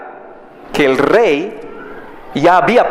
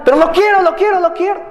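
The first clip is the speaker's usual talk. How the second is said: higher, mock childish whine; whining child; 11 semitones higher, faster than usual. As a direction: plead for the toy, a bit hammed-up. Child begging in a shop.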